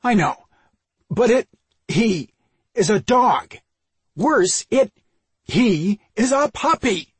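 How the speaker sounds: high, loud, halting outburst; exasperated demand. A narrator's reading. Brother arguing against puppy, exasperated demand. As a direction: stammer in bursts, building to an outraged question. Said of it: "he demanded".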